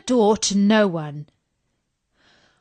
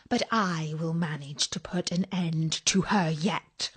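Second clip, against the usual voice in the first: quiet vow of revenge; 4 semitones lower, 7 dB quieter than usual. Acted. Queen vows revenge.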